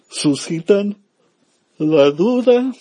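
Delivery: words squeak up high and loud as the voice cracks; a sudden high crack on suscitan la duda. Real, not acted. Priest's voice cracks mid-sermon to his listeners, high and squeaky. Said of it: "perdón".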